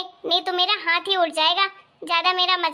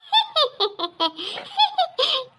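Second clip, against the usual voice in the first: mock giggle; 5 semitones higher than usual. Wife laughs at husband's praise.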